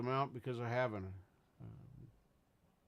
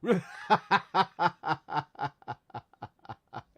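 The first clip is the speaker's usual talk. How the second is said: loud, long burst of laughter; real laugh, trailing off in pulses; 6 semitones higher, 10 dB louder than usual. Real, not acted. Livestreamer cracking up at chat, loud laugh.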